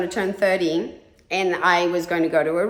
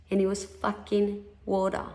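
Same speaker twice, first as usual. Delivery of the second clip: usual speaking voice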